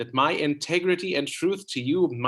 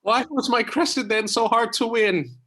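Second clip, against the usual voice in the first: eager plea; 10 semitones higher, 4 dB louder than usual. Acted. Troilus pleads.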